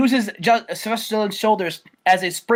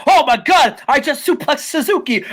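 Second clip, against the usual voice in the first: shocked realization; 6 semitones higher, 4 dB louder than usual. Retold or imitated. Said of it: "He's like".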